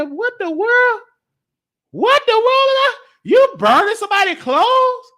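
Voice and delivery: high, startled cry of disbelief; shocked child. Retold or imitated. Kid seeing mama burn clothes, shocked and high. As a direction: gasp it out like a stunned kid.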